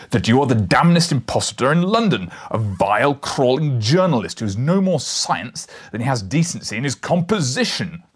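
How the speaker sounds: loud, raging denunciation; furious. A narrator's reading. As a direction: tear into the impostor. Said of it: "with a sudden blast of fury".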